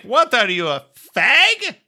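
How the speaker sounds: lightly put-on Mexican accent, rising high at the end. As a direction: imitate an offended woman with a Mexican accent. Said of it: "that was a very Mexican accent".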